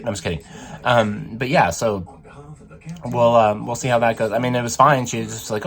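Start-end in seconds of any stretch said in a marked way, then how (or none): none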